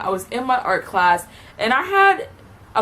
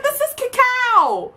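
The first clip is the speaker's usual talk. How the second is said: mock astonished realization; 14 semitones higher, slower than usual. Speaker's astonished reaction.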